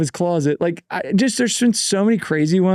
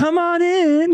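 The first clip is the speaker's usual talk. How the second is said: much higher, thin invitation; mock high-pitched welcome; high little voice; 12 semitones higher, slower than usual. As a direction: squeak it out like a tiny voice. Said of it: "He's like".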